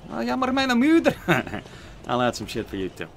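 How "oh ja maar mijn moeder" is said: high Flemish mock voice; mock Belgian Dutch. Streamer doing a high Flemish bit for a viewer. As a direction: do a squeaky Flemish line. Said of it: "some Belgium shit, you know?".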